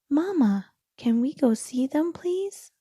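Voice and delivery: high, eager little kangaroo begging; pleading child voice. A narrator's reading. Little Roo begging his mother to see fireflies, high and eager. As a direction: beg Mama sweetly to go out.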